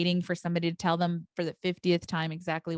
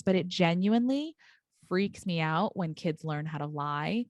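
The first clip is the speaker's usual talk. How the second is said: ordinary delivery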